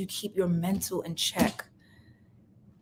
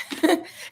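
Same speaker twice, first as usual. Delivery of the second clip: short high laugh; 7 semitones higher than usual. Real, not acted. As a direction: let a laugh burst out. Laughs after quote.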